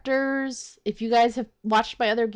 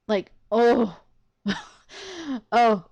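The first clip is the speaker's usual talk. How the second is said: usual speaking voice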